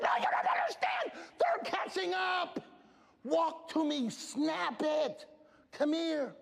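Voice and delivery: high, urgent yelling, slowing on the warning; urgent shout. Retold or imitated. Coach yelling at the girl to hurry back, urgent. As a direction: yell to hurry her along. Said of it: "I said".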